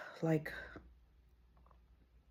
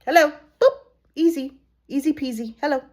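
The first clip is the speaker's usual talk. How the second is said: loud high singsong boop; playful; 6 semitones higher, 10 dB louder, faster than usual. Pretend. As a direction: bounce it like a cheerful game. Playful singsong mock greeting.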